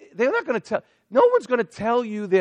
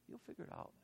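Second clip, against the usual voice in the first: whispered repeat, almost no voice; 13 semitones lower, 29 dB quieter, faster than usual. Retold or imitated. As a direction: breathe the line as a secret whisper. Pastor repeating answer to friend.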